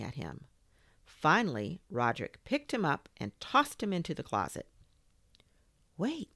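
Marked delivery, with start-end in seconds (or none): 6.0-6.3 a hint of a small, high voice; lightly voiced little creature calling out; small high plea from the dark. A narrator's reading. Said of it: "said a little voice from the darkness".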